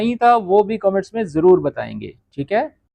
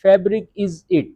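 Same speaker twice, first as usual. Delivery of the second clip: very slow English, word by word; teacher giving slow translation; much slower than usual. Shown as a demonstration. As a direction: read the English meaning out slowly. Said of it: "okay".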